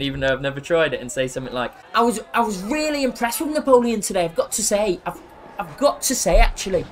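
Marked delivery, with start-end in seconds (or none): 1.9-6.8 lightly imitated football pundit; a weak, hesitant go at punditry; Gary Neville. Streamer pretending to discuss the first half, tentative pundit impression. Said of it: "good Gary Neville impression".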